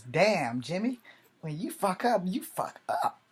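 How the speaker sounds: aunt teasing. Retold or imitated. Aunt ribbing nephew over his hip news, mildly mock-blunt. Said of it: "the first thing she said was".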